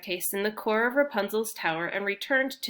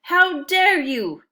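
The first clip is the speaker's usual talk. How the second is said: slow, booming troll roar, higher and much louder; troll shout; 9 semitones higher, 9 dB louder, much slower than usual. Acted. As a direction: bellow like an angry troll guarding his bridge. Troll angry on bridge.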